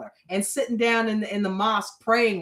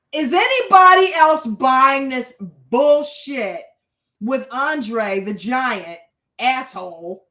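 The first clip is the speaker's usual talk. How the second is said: loud, slow, emphatic challenge; loud indignation; 7 dB louder, slower than usual. Real, not acted. Asking viewers if they buy it, loud indignation. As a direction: call out the nonsense, loud and drawn out.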